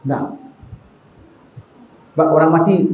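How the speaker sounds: usual speaking voice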